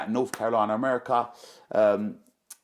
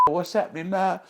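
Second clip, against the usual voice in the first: much higher, startled "what's happening now?"; alarmed question; 9 semitones higher than usual. Retold or imitated. Passenger startled by the aborted landing, alarmed. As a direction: blurt it out as the plane pulls up.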